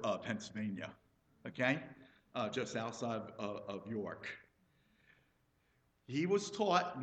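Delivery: usual speaking voice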